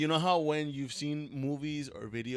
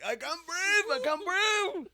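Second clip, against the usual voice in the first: much higher, gasping mock panic; mock panicked gasp; slower than usual. Man retelling a fart in the room, high mock panic. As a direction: gasp for air in mock horror. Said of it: "I was like".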